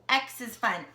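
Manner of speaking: plain reading voice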